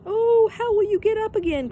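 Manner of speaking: high, loud frightened call; anxious cry. A narrator's reading. Annika calling down to trapped Pippi, high anxious cry. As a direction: cry out in alarm for a friend. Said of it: "cried Annika".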